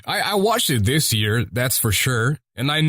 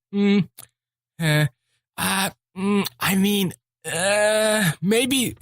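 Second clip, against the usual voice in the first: retold unsure humming and hawing, higher; hesitant pondering; 6 semitones higher, slower than usual. Scrolling through Amazon Prime, unsure, hesitant. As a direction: mull it over doubtfully in halting bits. Acting out doubt while scrolling.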